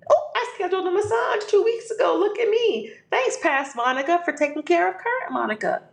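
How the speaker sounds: high, bright delighted self-voice; cheery self-talk. Pretend. Monica thanking her past self, high and delighted. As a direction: gush at your own past self. Acting out future self finding massage.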